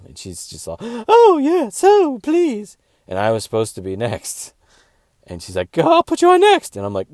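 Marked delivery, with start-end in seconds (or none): 0.8-2.8 put-on very high, loud gushing girly voice; mock sweet fawning. Club booker fawning over the headliner, squealing high. 5.7-6.7 put-on very high, loud gushing girly voice; mock sweet fawning. Club booker fawning over the headliner, squealing high.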